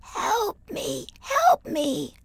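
high, crying plea for help; tiny voice calling for help from afar; small pleading voice. A narrator's reading. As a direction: cry out high and weak. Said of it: "said a voice".

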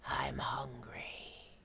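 soft, slow whispered growl; warning tiger. A narrator's reading. Tiger left waiting for his meal, hungry whispered warning. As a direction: let it out low and hungry. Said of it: "warned the tiger".

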